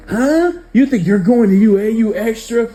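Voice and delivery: a bully yelling in a classmate's face; Bakugo snarling. Retold or imitated. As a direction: sneer it at the nerd. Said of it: "Bakugo would say".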